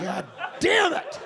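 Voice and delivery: high, slow, drawn-out cry; mock exasperation. Comedian crying out at the heckler through a laugh. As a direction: wail it in fake frustration.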